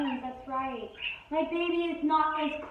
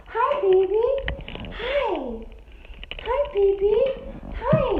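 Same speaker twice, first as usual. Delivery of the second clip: pet talk; 6 semitones higher, slower than usual. Real, not acted. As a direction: coo at the little bird. Greeting new bird in pet talk.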